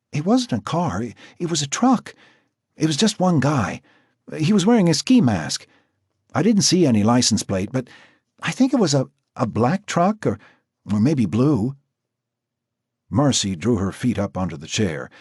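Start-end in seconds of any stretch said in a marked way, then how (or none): 0.1-11.8 put-on high, hesitant girl's voice; frightened girl. Marcy telling Jack about the truck, high and hesitant. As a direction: stumble through it, still scared.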